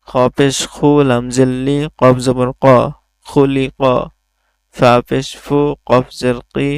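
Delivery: teacher chanting sound drills for pupils to repeat; steady sing-song classroom spelling; Qaida recitation drill. Shown as a demonstration. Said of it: "একসাথে পড়ব".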